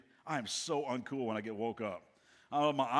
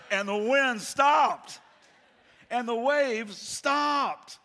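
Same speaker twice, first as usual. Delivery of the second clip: loud, high, drawn-out proclamation; dramatic shout; 9 semitones higher, 10 dB louder, much slower than usual. A narrator's reading. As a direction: shout each stop slowly, like a climax. Climax of the storm story.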